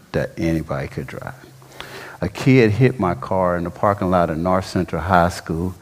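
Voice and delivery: ordinary talk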